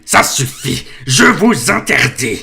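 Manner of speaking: panicking uncle shouts to silence the giant; panicked exclamation. A narrator's reading. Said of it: "s'exclama l'oncle Vernon pris de panique".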